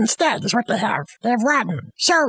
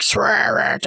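low, slow ghostly order; ghost command; 7 semitones lower than usual. Acted. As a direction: boom it from beyond.

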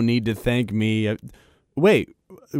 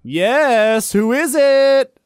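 singsong call; 10 semitones higher, 4 dB louder, much slower than usual. Acted. Answering a knock.

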